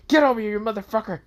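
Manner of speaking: fast, high, loud chasing shout; high-pitched angry holler; furious yell. Acted. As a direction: yell after someone running away. Draken chases Asta.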